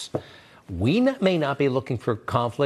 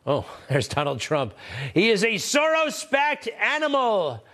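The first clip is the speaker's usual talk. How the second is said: mock tweet read; 9 semitones higher than usual. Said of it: "a tweet or a Truth Social that reads".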